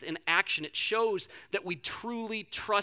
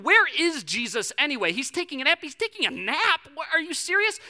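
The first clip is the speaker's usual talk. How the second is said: loud, high-pitched indignant panic; acted freaking out; 10 semitones higher, 9 dB louder than usual. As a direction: disciples in uproar, voice pitched way up. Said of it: "they are freaking out".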